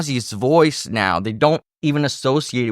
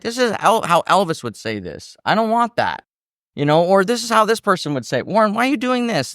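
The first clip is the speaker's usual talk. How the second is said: higher, quicker mock producer scolding; imitating the producer; 8 semitones higher than usual. Singer imitating his producer telling him off, higher and fast. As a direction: play the exasperated producer, lightly.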